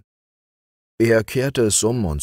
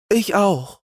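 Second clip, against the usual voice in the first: quick higher agreement; quick reply; 13 semitones higher than usual. A narrator's reading. Youth quickly agrees with Bertha, higher. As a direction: answer at once, eager. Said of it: "sagte Franz schnell".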